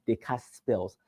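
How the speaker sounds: ordinary talk, nothing marked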